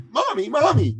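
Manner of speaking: put-on high-pitched child calling for his mother; excited little boy. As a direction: imitate an eager small boy, voice way up. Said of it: "all excited".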